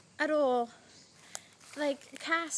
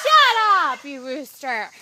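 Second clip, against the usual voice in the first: real irritated yell; 14 dB louder, slower than usual. Real, not acted. Vlogger interrupted by a crowing rooster, irritated yell. Yelling at a noisy rooster mid-video.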